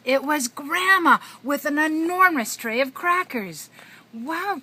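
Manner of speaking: high, loud singsong storytelling; broad singsong kids theatre. A narrator's reading. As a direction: ham it up for a toddler. Reading to a toddler.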